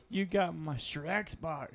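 drawn-out ogre impression; Shrek. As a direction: play a cartoon ogre making an accusation. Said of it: "he's like".

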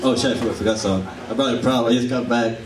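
normal talking voice

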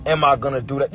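normal talking voice

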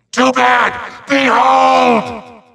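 high, shouted villain proclamation; hammy high-pitched announcement; grand reveal. Acted. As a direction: unveil it with a triumphant cry. Villain reveals his spell.

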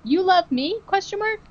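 put-on childlike high question; high-pitched child. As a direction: ask it like a small girl. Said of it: "really high-pitched".

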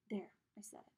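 breathy unvoiced whisper; whispered aside. Boy whispers his confession to Sarah and Ghost Boy.

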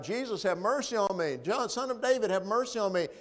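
high pleading call. Acted. Blind man calling after Jesus, pleading loudly. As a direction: plead for mercy over the crowd. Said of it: "hearing him call out".

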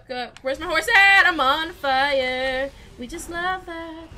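ordinary talk

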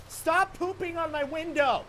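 high-pitched yell at birds. Real, not acted. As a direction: shout it up high. Yelling at birds.

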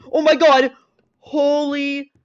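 startled loud cry; jumpy. Real, not acted. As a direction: jumps in fright, yelling. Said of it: "I'm so jumpy right now".

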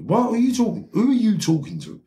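very high-pitched acted outrage; incredulous, indignant challenge, voice shooting up; getting angry. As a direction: re-enact losing your temper at someone. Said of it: "So now I'm getting angry".

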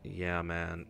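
low, slack American dude voice; quiet, low put-on American accent. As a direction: imitate a laid-back American. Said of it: "That's how you lots talk".

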